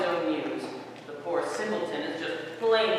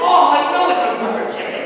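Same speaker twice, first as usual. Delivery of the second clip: loud, fast, higher retort; exasperated retort; 6 semitones higher, 9 dB louder, faster than usual. Acted. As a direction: snap back defensively. Defensive after being mocked.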